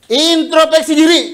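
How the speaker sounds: emphatic call. Acted. Preacher commanding the congregation to look inward, a loud high call. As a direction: fire "Introspeksi diri" out as a command. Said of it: "kuncinya itu aja".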